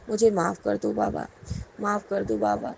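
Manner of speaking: normal talking voice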